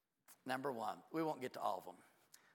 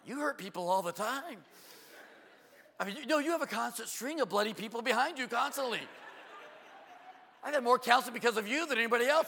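loud, high mock outburst; exasperated inner rant; 10 semitones higher, 8 dB louder than usual. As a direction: let loose the reply you held back. Acts out his exasperated inner reply.

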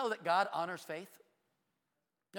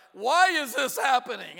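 drawn-out, louder mock wail of "why"; slow mock lament; 3 semitones higher, 6 dB louder, slower than usual. Preacher imitating people who blame God, as a slow whine. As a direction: stretch it like a self-pitying whine.

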